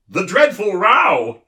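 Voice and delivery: high, loud and bright, slowed; eager know-it-all chirp; bright humbug. A narrator's reading. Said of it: "volunteered the humbug brightly".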